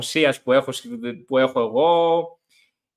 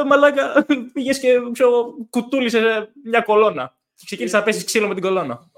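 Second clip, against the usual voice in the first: high-pitched mock retelling of a friend; animated, incredulous buddy voice; imitating amused friend; 9 semitones higher than usual. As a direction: imitate a friend recounting it, much higher. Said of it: "την επόμενη μέρα να μου λέει".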